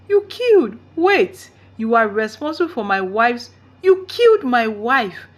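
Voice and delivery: high, loud, slowing shocked accusation; rising horror into accusation; horrified outcry. A narrator's reading. Father learning his mother killed his wife, outcry. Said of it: "Dad asked".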